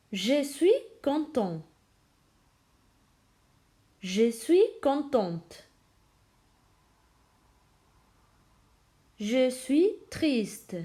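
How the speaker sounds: slow, carefully pronounced model phrases; repeat after me. Shown as a demonstration. Teacher models phrases for students to repeat, slow and careful. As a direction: model each phrase slowly for the class to echo. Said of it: "Répétez avec moi".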